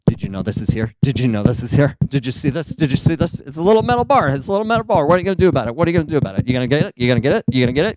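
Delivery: rapid repeats rising to high nagging; pestering tongue voice. Acted. Tongue fussing at the new metal bar, insistent repetitive nagging. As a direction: obsessively pester in a put-on voice. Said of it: "my tongue was just like".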